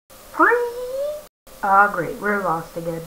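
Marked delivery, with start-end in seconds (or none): none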